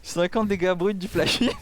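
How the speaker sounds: ordinary talk, nothing marked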